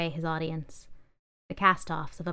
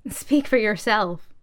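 louder, slow snicker of a reply; 4 semitones higher, 5 dB louder, slower than usual. Acted. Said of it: "snickered a yellow toothbrush".

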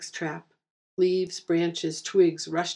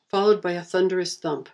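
ordinary delivery, nothing marked

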